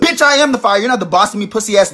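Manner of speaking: loud, high defiant shout; fiery retort. Acted. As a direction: snap back loud and proud. Aries refusing an order.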